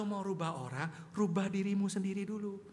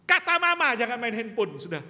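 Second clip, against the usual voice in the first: scolding; 2 semitones higher, 12 dB louder than usual. Retold or imitated. Father scolding child.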